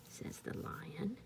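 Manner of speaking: ordinary delivery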